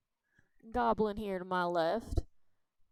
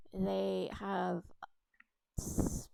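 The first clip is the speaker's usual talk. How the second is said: whisper; much slower than usual. Acted. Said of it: "unvoiced whisper".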